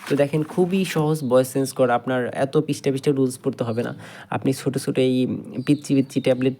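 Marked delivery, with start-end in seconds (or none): none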